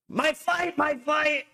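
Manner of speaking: mocking imitation of a rabbi's shrill voice. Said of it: "this is how he talks".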